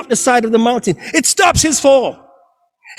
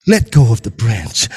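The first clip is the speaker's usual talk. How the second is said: voice of God; 14 semitones lower than usual. Acted. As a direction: speak calm and low as God. God commands the climber.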